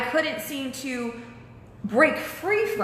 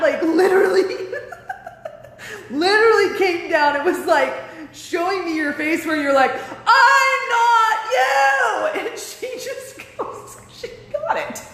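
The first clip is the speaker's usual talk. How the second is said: high, laughing retelling; real laughter breaking through; 10 semitones higher, 4 dB louder than usual. Real, not acted.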